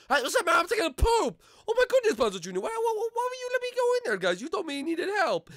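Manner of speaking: high-pitched indignant hollering; loud comic outburst of protest; outraged yelling. Acted. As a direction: yell at them, scandalized and very high. Acted quarrel, walked in on toilet.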